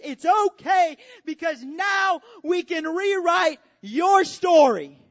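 Jesus crying out his promise at the top of the hill; shouted triumphant promise. Acted. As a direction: shout it from the hilltop. Jesus's words on the hilltop.

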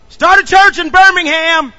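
God commanding the preacher. Retold or imitated. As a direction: proclaim it like a divine order. Quoting God's command.

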